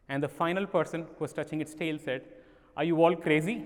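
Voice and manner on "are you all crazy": louder, scoffing retort; exasperated outburst. Acted. Blind man holding the tail scolding the others, louder exasperated. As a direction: scold the others as if they're fools.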